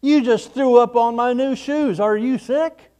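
high mock complaint about shoes; mock grossed-out. Shown as a demonstration. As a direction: pitch it up, grossed out. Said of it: "Sick used to mean".

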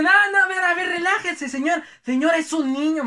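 calm him down in a panic; mother begging the angry father to back off; frantic pleading. Retold or imitated.

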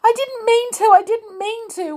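high-pitched tearful pleading; weeping. A narrator's reading. Amelia pleads innocence to the toys, weeping. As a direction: wail it like a distraught child. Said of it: "wept Amelia".

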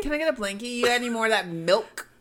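mock childlike request. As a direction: playfully beg like a kid settling in for a movie. Mocking man settling in for movie.

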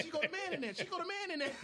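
high laughing repeat. Real, not acted. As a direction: say it cracking up. Laughing retelling.

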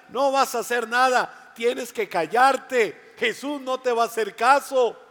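mock him for hoping; scornful shout. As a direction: crowd belittling the beggar, scornful. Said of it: "la gente literalmente le decía".

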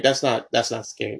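ordinary talk, nothing marked

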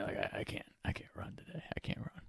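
put-on faint whisper of a sick kid begging off; whispered pleading. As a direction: barely breathe that you can't run. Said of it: "Beef's like".

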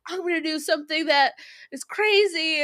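high, put-on heroic declaration; mock hero voice. Reviewer mimics [name]'s pilot vowing something crazy, high put-on voice. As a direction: play the doomed sidekick grandly. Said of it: "And then he's like".

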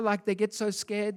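ordinary delivery

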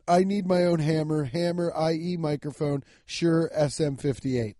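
normal talking voice

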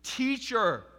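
high, loud joyful cry; a loud joyful exclamation; exclaimed. Acted. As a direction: cry it out in recognition. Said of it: "she yells, exclamation point".

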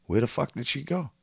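ordinary talk, nothing marked

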